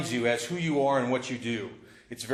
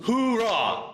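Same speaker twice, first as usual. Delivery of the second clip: high, loud army cheer; loud gung-ho soldier; 6 semitones higher than usual. Shown as a demonstration. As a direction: shout it like a drill sergeant. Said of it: "Come on, do this one more time".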